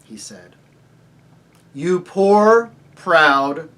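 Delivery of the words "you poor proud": loud, commanding summons; louder lion voice. A narrator's reading. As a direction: call out with authority. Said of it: "spoke in a louder voice".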